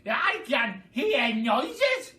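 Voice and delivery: high, loud, slow suspicious screech; Grandma screeching suspiciously. A narrator's reading. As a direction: drag it out like a nosy old crone. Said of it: "she screeched".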